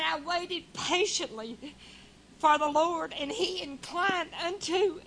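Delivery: slow, solemn reading that swells to a cry at the end; scripture recitation. Acted. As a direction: read it like a preacher lifting the verse.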